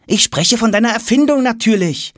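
put-on high-pitched, impatient boy's voice; exasperated boy. A narrator's reading. Tom explains to father about the invention, exasperated. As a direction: spell out the obvious in a high voice.

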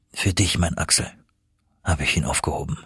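low, soft and tender; tender confession. A narrator's reading. Uncle saved the last drop for his nephew, tender confession. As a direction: confide it quietly to someone dear.

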